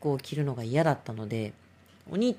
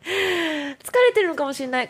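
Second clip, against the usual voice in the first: much higher and louder, laughing through it; laughing repeat; 12 semitones higher, 4 dB louder than usual. Real, not acted. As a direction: toss it off again with a laugh, way up high. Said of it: "なんか疲れてるのかもしれない。". (笑いながら高い声で繰り返す)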